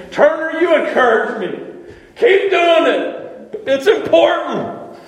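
a preacher getting carried away with joy; fired up. Real, not acted. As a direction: let the passion lift the pitch and volume. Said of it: "Sorry, I'm getting a little fired up".

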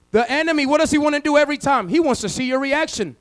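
ordinary talk

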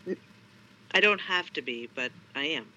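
ordinary delivery

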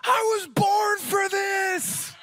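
put-on high, slow, triumphant kid's battle cry; excited little boy. Son running into freezing water, high triumphant shout. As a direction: shout it like a five-year-old charging into the sea. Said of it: "and he goes".

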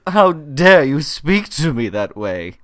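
slow, haughty rich lady; drawn-out indignant rich lady. Retold or imitated. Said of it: "Goldie Hawn is doing great rich lady voice".

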